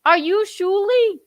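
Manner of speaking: high, loud put-on voice; Stuttering John. Pretending to be Stuttering John calling the Shuli Network. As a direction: play him as an eager caller. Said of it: "It's me, Stuttering John".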